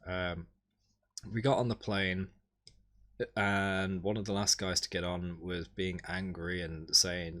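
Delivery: ordinary delivery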